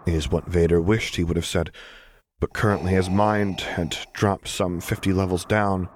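usual speaking voice

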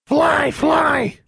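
very high, loud raven screech; squawking raven. Acted. Raven taking off through the window, squawking. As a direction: cry out as a talking bird. Raven character cries out in story.

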